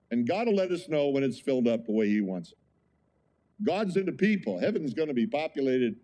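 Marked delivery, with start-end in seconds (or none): none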